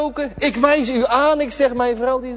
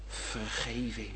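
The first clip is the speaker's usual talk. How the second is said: slow, very soft whisper, much lower; whispered forgiveness, drawn out; 11 semitones lower, 17 dB quieter, slower than usual. Acted. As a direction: let it fall slowly like a secret. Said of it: "En hij fluistert het in mijn oor".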